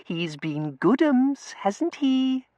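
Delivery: slow, high-pitched coo; drawn-out simpering baby voice; cooing baby talk. A narrator's reading. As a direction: coo sweetly, as to a pet. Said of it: "Tossie cooed".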